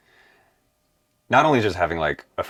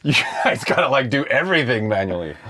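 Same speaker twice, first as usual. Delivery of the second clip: surprised realization; 8 semitones higher, 4 dB louder than usual. Retold or imitated. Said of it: "I was like".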